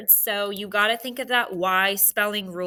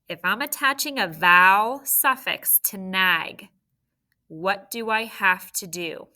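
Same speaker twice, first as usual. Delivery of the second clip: usual speaking voice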